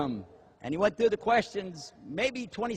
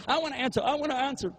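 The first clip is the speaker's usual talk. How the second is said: high, eager pleading to answer; mock eager; 7 semitones higher, faster than usual. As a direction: beg to be picked, mocking. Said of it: "And we're all going".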